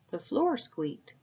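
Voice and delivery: plain reading voice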